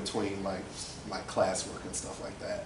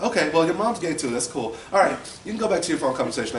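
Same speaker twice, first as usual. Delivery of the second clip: loud, quick imitation of an aunt; breezy dismissal; 8 semitones higher, 6 dB louder, faster than usual. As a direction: wave it off briskly and loudly.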